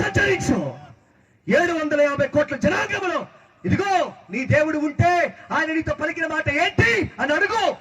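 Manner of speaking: high, driving pulpit challenge; full-force preaching. Real, not acted. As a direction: hurl the challenge out, high-pitched and urgent. Preacher issues a rousing challenge. (ఆవేశంగా సవాలు చేస్తూ ఎత్తైన స్వరంతో ప్రసంగం)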